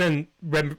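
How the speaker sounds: ordinary delivery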